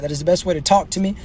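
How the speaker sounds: ordinary delivery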